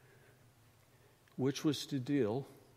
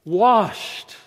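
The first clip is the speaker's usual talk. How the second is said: proclaiming; 6 semitones higher, 13 dB louder than usual. Real, not acted. Preacher proclaims, then repeats softly.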